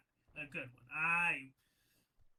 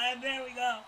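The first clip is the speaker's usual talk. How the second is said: usual speaking voice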